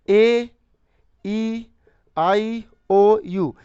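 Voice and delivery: slow listing. Shown as a demonstration. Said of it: "teacher listing vowels".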